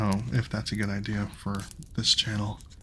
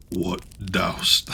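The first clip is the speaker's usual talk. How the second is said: giant voice; 3 semitones lower, 3 dB louder than usual. Acted. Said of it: "I don't know if that's a good voice".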